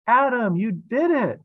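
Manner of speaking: high, loud, slow cheer; joyful shout. Acted. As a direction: cheer him on from below. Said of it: "King Aaron shouted".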